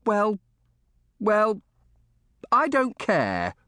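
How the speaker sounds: put-on high-pitched, stubborn boy voice; a halting, slow "don't care" from a sulky boy; stubborn retort. A narrator's reading. Boy defying a scolding question.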